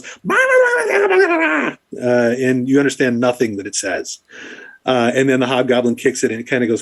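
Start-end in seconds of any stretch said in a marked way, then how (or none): 0.0-2.0 very high, laughing gibberish babble; creature babble. Acted. Little creature babbling up at Sophia, high laughing gibberish. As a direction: babble like a giddy little beast. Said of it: "and just goes".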